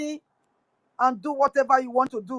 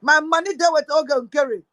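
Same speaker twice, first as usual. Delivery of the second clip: usual speaking voice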